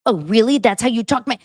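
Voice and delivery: lightly hammed-up husband mocking his wife. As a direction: parody her as her husband would. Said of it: "he tries to pretend to be me".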